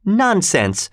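high-pitched indignant exclamation; angry girl's dismissal; angry cry. A narrator's reading. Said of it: "cried Kathy angrily".

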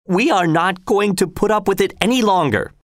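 high-pitched, emphatic repetition; defiant protest. A narrator's reading. Arnold repeating his protest to the governor, high and defiant.

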